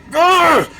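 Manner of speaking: short playful growl through teeth; mock growl. Mock growl at a sad movie. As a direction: growl like an angry dog.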